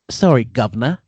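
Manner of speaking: a hammed-up fake Cockney apology; mock British, lightly put on; bad British accent. Host mocking the bad impersonator, in a fake British accent. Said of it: "I'm a professional at doing bad accents".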